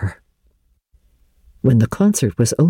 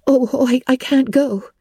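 flustered stammer, pitched high; a girl tripping over her words; confused; 12 semitones higher than usual. Acted. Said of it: "said Anne confusedly".

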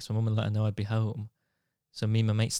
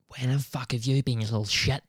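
angry New Zealand mum. Retold or imitated. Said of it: "just flicks".

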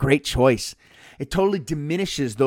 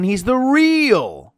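"real" pulled long, high and loud; stress on REAL; 9 semitones higher, 6 dB louder, much slower than usual. Retold or imitated. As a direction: lean hard on "real" and draw it out. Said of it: "the emphasis on the word real".

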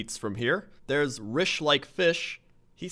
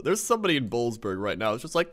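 usual speaking voice

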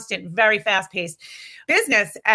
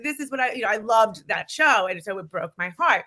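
ordinary delivery

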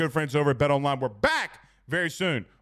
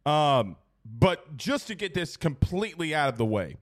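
ordinary delivery

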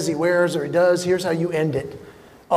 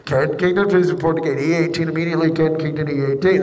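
2 imitating loudspeaker; 6 semitones lower than usual. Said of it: "over the loudspeaker".

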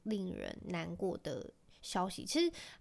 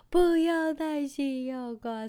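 high, louder, slow tearful wail; imitating child sobbing; 6 semitones higher, 8 dB louder, much slower than usual. Son begs mom not to lose patience, sobbing slowly. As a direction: bawl it like a little boy. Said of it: "然后就开始爆哭".

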